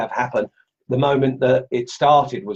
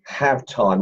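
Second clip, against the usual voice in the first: ordinary talk, nothing marked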